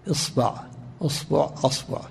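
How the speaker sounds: إصبع، أصبع، أصبع with fatha on the ba, isbaʕ, usbaʕ, asbaʕ; أصبع forms ending in -baʕ with varied first vowel; vowel variants. Shown as a demonstration. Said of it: "تفتح الباء وتثلثها".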